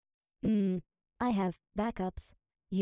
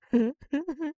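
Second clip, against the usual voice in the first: evil laugh; 5 semitones higher, 5 dB louder than usual. Acted. Villain laughing at victim.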